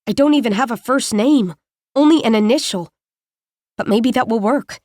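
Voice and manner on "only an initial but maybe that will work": ordinary delivery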